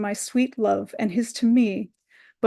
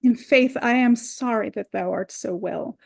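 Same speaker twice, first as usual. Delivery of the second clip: ordinary talk, nothing marked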